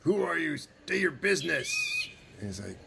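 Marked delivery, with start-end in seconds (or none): 0.0-1.7 high-pitched, officious sentry; challenging guard. Acted. Guard to stranger at town gate, challenging. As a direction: demand it from up at the gate.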